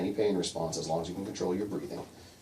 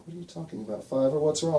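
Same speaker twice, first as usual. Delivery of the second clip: higher-pitched, worried questioning; alarmed Hazel; 7 semitones higher, 3 dB louder than usual. Acted. Storyteller voices Hazel questioning Fiver, alarmed. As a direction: demand answers of a troubled brother, voice lifting.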